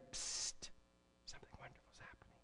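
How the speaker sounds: soft, secretive whisper of good news; a fast, gentle whisper, as if sharing a joyful secret; sweet whisper. Retold or imitated. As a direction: lean in and breathe it like a secret. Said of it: "as if it's a sweet whisper".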